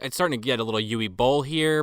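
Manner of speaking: ordinary talk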